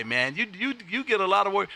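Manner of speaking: ordinary delivery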